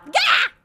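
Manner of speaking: sudden high shout of fright; loud, high startled gah; startled yelp. Acted. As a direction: jump out of your skin and yelp. Startled by ghost appearing.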